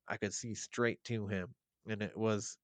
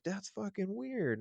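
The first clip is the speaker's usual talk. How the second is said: weirded-out ex-wife; 11 semitones higher than usual. Retold or imitated. Ex-wife voiced in retold story.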